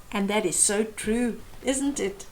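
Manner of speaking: usual speaking voice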